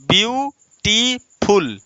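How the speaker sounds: "Beautiful" drawn out as view, टी, फुल, pausing between; syllable by syllable. Shown as a demonstration. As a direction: lead a class through "beautiful" in three slow, separate syllables. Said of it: "बोलिए". ("Beautiful" को रुक-रुक कर बुलवाएँ: ब्यू... टी... फुल)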